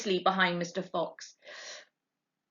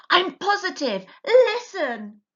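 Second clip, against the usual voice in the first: urgent Mr Fox; 11 semitones higher, 6 dB louder than usual. A narrator's reading. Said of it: "Mr Fox urgent".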